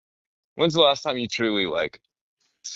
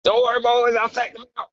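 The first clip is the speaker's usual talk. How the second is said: high, loud mock war-hero cry; hammed-up sacrifice on the battlefield; heroic soldier; 5 dB louder than usual. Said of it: "gets injured on the battlefield and blows himself up".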